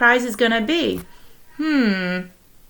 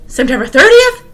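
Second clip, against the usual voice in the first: loud, high, eager question; excited; 11 semitones higher, 8 dB louder than usual. Acted. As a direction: burst out with excitement. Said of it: "said Mister Popper in an excited tone".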